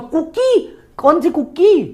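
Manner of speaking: high, puzzled "cookie" rising up; baffled. Retold or imitated. Confused man reading the café menu, high and baffled. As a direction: squint at the strange word.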